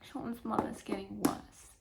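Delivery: soft slow whisper. Real, not acted. Reader sharing the grim news, slow whisper. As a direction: hush it sadly.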